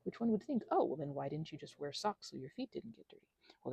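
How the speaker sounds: ordinary talk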